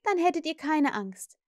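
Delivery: proud little mother's certainty; triumphant. A narrator's reading. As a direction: conclude with mild triumph. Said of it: "erklärte Wendy triumphierend".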